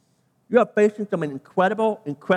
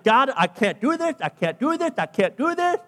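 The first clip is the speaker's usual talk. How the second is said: higher-pitched mock protest, repeating the excuse; acted-out objection, rising to an incredulous question; 8 semitones higher than usual. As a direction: plead your way out, voice climbing. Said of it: "he protested".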